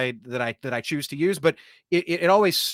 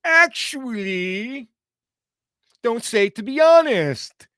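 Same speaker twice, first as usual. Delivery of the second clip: higher, slow, stretched "Actually"; pedantic know-it-all voice; 7 semitones higher, 5 dB louder, slower than usual. Retold or imitated. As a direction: drag out each "Actually" like a snob. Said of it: "that friend of mine is kinda going".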